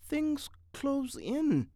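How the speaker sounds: slow, absent-minded murmur; vague. A narrator's reading. Mitty answering his wife from a daydream, vague. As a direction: drift through it as if half in a dream. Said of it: "said Walter Mitty vaguely".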